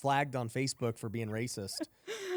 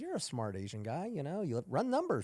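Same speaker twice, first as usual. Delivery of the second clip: mocking pitch; 9 semitones higher than usual. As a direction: needle him higher and higher. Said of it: "I'm like".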